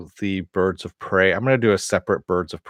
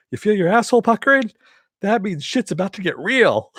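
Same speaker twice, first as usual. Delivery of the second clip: much higher, fast, loud mock delivery; quoting J B Smoove; 12 semitones higher, 3 dB louder than usual. Host imitating J B Smoove's line, high and fast. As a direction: fire it off like a wild comic riff. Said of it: "J B Smoove with the".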